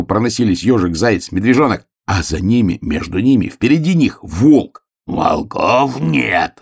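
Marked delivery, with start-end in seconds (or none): 5.1-6.6 very slow, stretched growl; рычание волка. A narrator's reading. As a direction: growl it out, dragging the vowels. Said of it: "рычал он".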